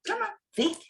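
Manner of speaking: sing-song high coaxing to a pet; coaxing her dog with high calls. Real, not acted.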